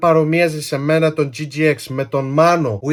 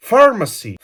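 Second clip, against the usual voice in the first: PHARMACY higher, in a fluent English accent; authentic English accent; 6 semitones higher than usual. Shown as a demonstration. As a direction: say "Pharmacy" like a native English speaker. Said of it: "μιλάω με αυτή την προφορά".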